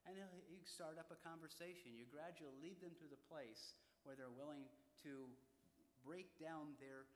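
ordinary delivery

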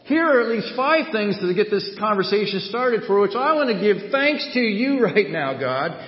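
high, loud, impassioned sermon delivery; forceful preaching. Real, not acted. Preacher refusing to go sour over unmet expectations, forceful preaching. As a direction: preach it hard, peaking on a defiant cry.

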